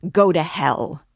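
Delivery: loud, forceful curse; angry dismissal. A narrator's reading. Raskolnikov sending Luzhin away, angrily loud. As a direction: throw him out with one burst.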